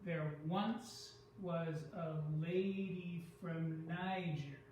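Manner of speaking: slow limerick lilt, leaning on "once", "lady", "Niger", "bum", "rode", "back", "tiger"; steady metrical beat. Shown as a demonstration. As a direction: recite slowly to show the meter, stressed "once" and "lady" held long. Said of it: "there's a beat".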